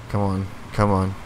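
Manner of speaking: ordinary talk, nothing marked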